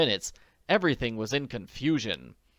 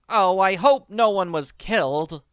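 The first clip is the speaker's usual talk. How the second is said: anxious; 8 semitones higher, 8 dB louder than usual. Acted. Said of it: "murmured anxiously".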